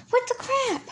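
high, surprised exclamation; sudden shock at the missing pencil; startled. Real, not acted.

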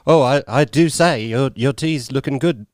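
slow, posh English drawl; posh English accent. Pretend. Downton Abbey version, posh English accent. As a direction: play a slow, refined aristocrat. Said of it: "wouldn't be doing the Cockney one".

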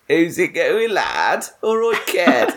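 exaggerated Kiddie accent, swooping pitch. Shown as a demonstration. Host showing his phone greeting to Josh, Kiddie accent. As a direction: lay the Kiddie accent on thick. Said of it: "I do this accent where I go".

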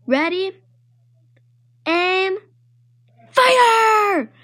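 building up to a loud shouted Fire; excited shout. Acted. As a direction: count down, then yell Fire. Child playing target game.

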